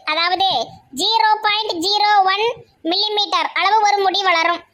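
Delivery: usual speaking voice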